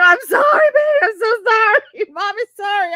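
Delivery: high, loud hammed-up crying apology; mock sobbing. Mother begging toddler's forgiveness, mock sob. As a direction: wail sorry like a melodramatic mom.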